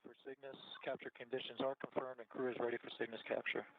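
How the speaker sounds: distant, fast, muffled radio call; squeezed through a weak radio link; breaking up space radio. Real, not acted. Crew calling over space radio.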